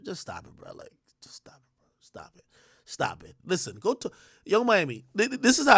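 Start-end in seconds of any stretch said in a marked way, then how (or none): 0.0-1.9 breathy hushed whisper, voice almost gone; soft unvoiced plea to stop. Real, not acted. Whispered aside mid rant. 2.0-2.4 hushed unvoiced stop it; "Stop it." whispered, no voice. Real, not acted. Whispered then said aloud.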